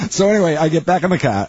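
lively Boston accent; Dorchester accent. Shown as a demonstration. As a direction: pick the story back up in Dorchester speech. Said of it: "this accent".

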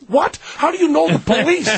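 high, incredulous question. Retold or imitated. Doubting the stranger knows the police, high-voiced. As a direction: pitch it up in disbelief. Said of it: "Stop with the high voice".

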